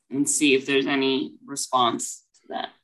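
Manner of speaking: ordinary talk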